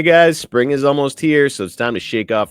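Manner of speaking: loud, upbeat sponsor read. Acted. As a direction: pitch it like a radio ad. Starts ad read.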